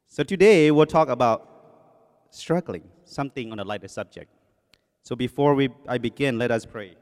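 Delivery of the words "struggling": ordinary delivery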